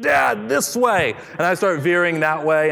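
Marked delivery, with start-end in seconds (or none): 0.0-1.1 put-on high, slow eager child call; toddler calling out. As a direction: call out like an excited toddler steering.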